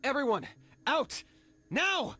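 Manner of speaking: high, loud shouted order; urgent shout. Acted. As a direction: yell at everyone to get out. Urgent evacuation call.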